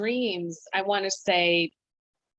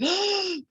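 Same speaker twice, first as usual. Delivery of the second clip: a sudden high gasp, acting out losing her breath; sharp gasp for breath; 13 semitones higher than usual. Retelling waking in panic, a sharp gasp. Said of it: "catching my breath".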